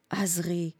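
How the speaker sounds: soft, subdued calling of her name; hushed tender call. A narrator's reading. Fighter cradling the dying old woman's head, hushed call. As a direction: say the name gently, very softly.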